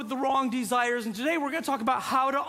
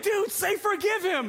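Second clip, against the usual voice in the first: high, slow, insistent urging; urgent prompting; 8 semitones higher than usual. Retold or imitated. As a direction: imitate a dad pressing his kid. Dad urgently prompting his son.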